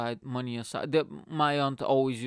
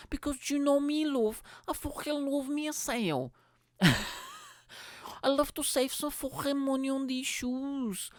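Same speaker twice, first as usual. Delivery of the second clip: high, sweary mock Scouse woman; mock Scouse girlfriend; 11 semitones higher than usual. Girlfriend telling boyfriend she loves a sale, high mock Scouse voice.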